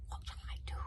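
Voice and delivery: breathless whispered question, barely voiced; mouthed whisper. A narrator's reading. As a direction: mouth the words so no one hears. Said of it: "mouthed Fishlegs".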